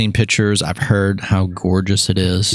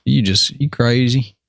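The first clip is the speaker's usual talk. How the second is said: put-on Alabama accent, pitched up, slow in stretches; 4 semitones higher, slower than usual. Showing off for a guest on request, put-on Alabama accent. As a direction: ham up an Alabama country accent. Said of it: "Throw down your Alabama accent already".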